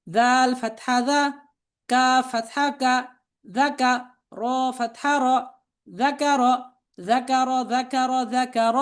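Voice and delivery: level, measured chant with drawn-out letter names; spelling drill recitation. Shown as a demonstration. Teacher spelling out Arabic words for learners to repeat, chanted drill.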